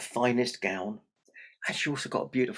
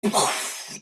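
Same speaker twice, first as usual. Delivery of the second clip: big storyteller's gasp; shocked gasp; 7 semitones higher, 3 dB louder than usual. Acted. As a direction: gasp as if found out. Imp hears his name.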